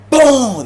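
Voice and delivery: loud high boom for the crash; impact boom. Retold or imitated. Narrating Unc's head hitting the bunk, loud crash sound. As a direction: slam the sound out.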